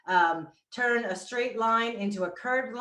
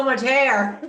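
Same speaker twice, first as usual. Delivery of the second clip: laughing through the words; 4 semitones higher, 6 dB louder than usual. Real, not acted. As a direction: laugh it out, louder. Laughs about her hair.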